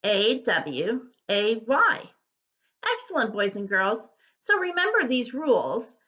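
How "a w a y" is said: slow letter-by-letter spelling; letters set apart, one at a time. Shown as a demonstration. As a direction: spell it slowly for learners. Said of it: "How would you spell away".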